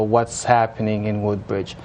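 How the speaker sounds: ordinary delivery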